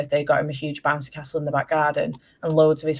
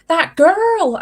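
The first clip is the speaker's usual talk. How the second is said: put-on toddler voice; 13 semitones higher, 9 dB louder than usual. Imitating toddler son pointing out.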